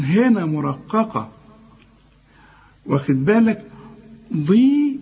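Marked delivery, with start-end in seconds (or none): 4.4-5.0 ضي high, with a light dad and kasra. Shown as a demonstration. Teacher isolating the syllable, high clear ضي. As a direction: say ضي like the start of ضياء. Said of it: "الضاد مكسورة زي ما تقول ضياء".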